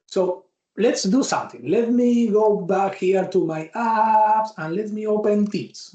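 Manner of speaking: normal talking voice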